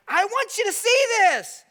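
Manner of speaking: high, loud insistence; emphatic call. Acted. Jesus urging the crowd to notice greed, near shout. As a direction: make them see it.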